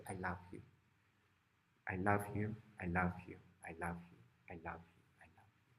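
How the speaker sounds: low and soft, fading to a whisper; tender repeated refrain. Acted. Pig giving love to every animal.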